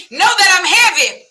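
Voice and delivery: high, loud warning boast; hammed-up tough brag; comic loud boast. Bragging about carrying a gun.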